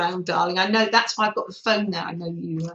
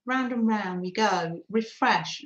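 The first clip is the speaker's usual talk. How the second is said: ordinary talk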